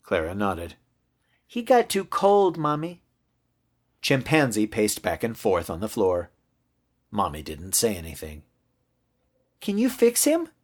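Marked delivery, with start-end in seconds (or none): 1.5-3.0 put-on little girl. Girl explains to Mommy. 9.6-10.5 put-on very high child's question; high-pitched childlike plea; hopeful little girl. Girl begs Mommy for help.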